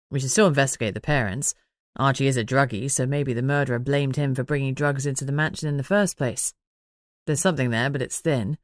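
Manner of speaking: lightly voiced pondering man; Manners, musing.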